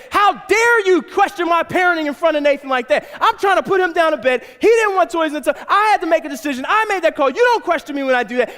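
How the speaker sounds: high, loud indignant rant; angry tirade. Acted. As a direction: tear into your wife for questioning you. Acted quarrel with wife.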